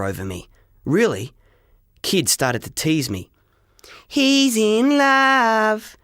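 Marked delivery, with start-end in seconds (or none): 4.1-6.0 high, slow sing-song taunt; mocking schoolgirl chant; teasing girl. A narrator's reading. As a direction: tease him like a schoolgirl. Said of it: "said a girl called Simone".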